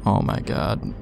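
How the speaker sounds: ordinary talk, nothing marked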